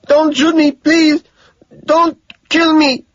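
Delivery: put-on very high, loud pleading with a foreign accent; panicked cry in broken English. A narrator's reading. Terrified cab driver pleading with the gunman, high and loud. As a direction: beg for your life in mangled English. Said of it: "cries out in mangled English".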